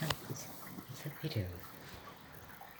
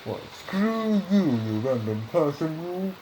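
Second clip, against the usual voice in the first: insulting robot voice; 3 semitones higher, 9 dB louder, slower than usual. Pretend. Brain voice insults the speaker.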